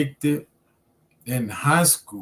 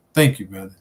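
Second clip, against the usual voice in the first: usual speaking voice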